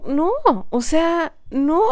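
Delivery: high, drawn-out firm no; emphatic refusal. Shown as a demonstration. Telling the listener to refuse the boss, an emphatic high no. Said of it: "Tú tienes que decir".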